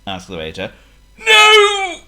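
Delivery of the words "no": high loud wheezy no; wheezed no. A narrator's reading. Potto refuses the bill, high wheezy cry. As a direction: push out a stuffed wheeze. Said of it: "wheezed Potto".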